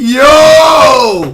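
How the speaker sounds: loud mocking yell; jeering shout. Real, not acted. Roasting the host on air, mocking yell. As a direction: call it out to clown him. Mocking Envy about to cry.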